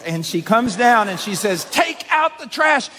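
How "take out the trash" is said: high, slow, put-on nagging wife voice; mocking nagging wife. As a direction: ham up the shrill demand of a nagging wife.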